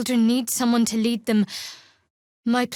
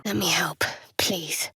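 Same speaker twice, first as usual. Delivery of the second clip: soft, lower whisper of a plea; pleading whisper; 5 semitones lower, 4 dB quieter than usual. Acted. Begging a wounded companion to accept help, whispered. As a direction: beg softly, almost breaking.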